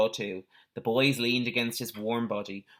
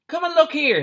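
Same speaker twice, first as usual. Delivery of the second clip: much higher and louder, calling across the field; 9 dB louder than usual. A narrator's reading. Man at the gate calls his partner over, loud call. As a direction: shout it over to his mate. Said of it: "he called to the other man".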